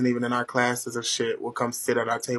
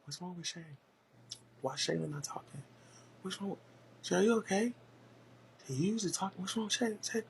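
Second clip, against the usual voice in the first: much softer, murmured worried questions; hushed classmates whispering; 6 semitones higher, 13 dB quieter than usual. Retold or imitated. Classmates whisper about silent Shayla, hushed and worried.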